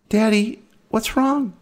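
put-on high, worried little girl; scared child voice. A narrator's reading. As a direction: ask like a frightened daughter. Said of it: "Aaron asked".

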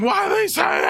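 screaming question. Acted. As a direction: scream it across the chaos.

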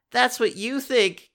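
high mock snap; snapping back. Katie snaps at Jordan, lightly hammed-up. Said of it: "Katie snaps".

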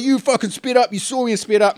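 retold heated accusation; mock road-rage accusation. Acting out the angry driver.

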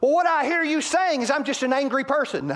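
a hammed-up defensive mate, high and loud; defensive spouse. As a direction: play the hurt spouse twisting what was said. Defensive spouse mishears partner.

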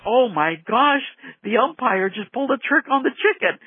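high, excited mock fan cry; mock scream from the stands; screaming fans, imitated. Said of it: "The fans are screaming".

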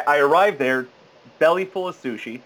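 normal talking voice

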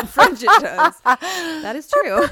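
loud, high, stretched-out laughing; helpless laughter through the words; laughing hard. Real, not acted. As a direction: crack up while echoing a friend. Cracking up at co-host's joke.

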